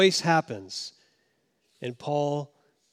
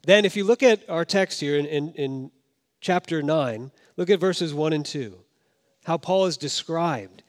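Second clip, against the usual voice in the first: ordinary delivery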